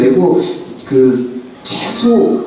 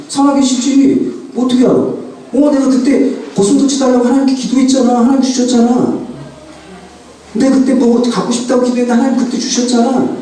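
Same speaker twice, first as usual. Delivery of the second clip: lightly voiced young son, higher pitch; child's answer; 6 semitones higher than usual. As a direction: speak as an eager little boy, higher. Son answers father's question.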